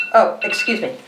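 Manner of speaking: plain reading voice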